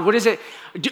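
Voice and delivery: ordinary talk, nothing marked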